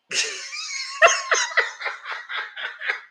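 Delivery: high-pitched cackling laughter; long high laugh. Real, not acted. Storyteller cracks up at own Dalmatians joke, high laughing fit.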